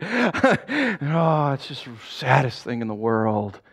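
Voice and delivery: hammed-up sympathy, sinking low; mock sadness. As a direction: pretend to share the mourners' grief. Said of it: "a lot of people were all sad".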